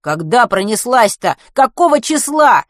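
loud, stretched, insistent questions; impatient. A narrator's reading. Matvey demanding the comet's date from the driver, impatient. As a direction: press hard for the date, rising high. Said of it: "нетерпеливо спросил Матвей".

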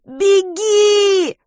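very high, loud, crying out; panicked cry; terrified scream. A narrator's reading. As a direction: scream at him to flee.

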